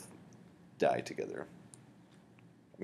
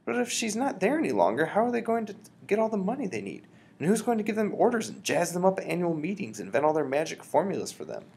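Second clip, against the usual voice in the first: put-on higher, worried little boy voice; higher boy voice, anxious; young boy; 10 semitones higher than usual. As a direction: keep pressing grandmother with questions. Boy presses his grandmother.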